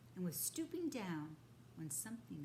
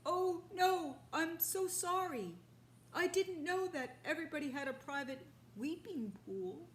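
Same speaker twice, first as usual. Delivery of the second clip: higher, louder, hurried sorry; flustered apology; 10 semitones higher, 7 dB louder than usual. Acted. Father apologising to the rhinoceros, flustered. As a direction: plead while hanging in the air.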